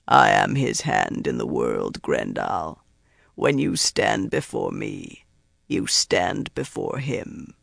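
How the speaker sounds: very deep, slow inhuman voice; low and deliberate, as the dark lord's hand; menacing Myrddraal. A narrator's reading. Myrddraal asserting its authority over Grendal, menacing.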